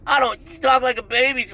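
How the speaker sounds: high, babyish mimicry of the guy; mocking the other guy's voice, pitched high like a baby. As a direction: mimic him talking like a baby to mock him. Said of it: "he's trying to fucking switch your voice".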